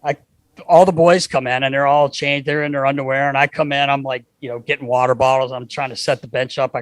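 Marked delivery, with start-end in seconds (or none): none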